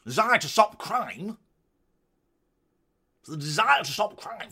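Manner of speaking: mock-posh newsy voice, high and loud. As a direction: exaggerate the posh reporter accent. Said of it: "very posh".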